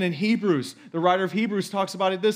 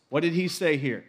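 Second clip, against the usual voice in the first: ordinary delivery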